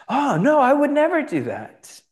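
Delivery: high, loud, horrified refusal; mock horror. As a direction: recoil in hammed-up horror. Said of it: "Peter's horrified at this".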